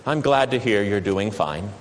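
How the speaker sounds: low, bored monotone; no joy, just obligation; depressing monotone. Retold or imitated. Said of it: "in a depressing, monotone voice".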